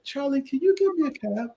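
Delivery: high, put-on posh British accent; an American in London showing off his new British accent. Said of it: "Well, what's with this voice?".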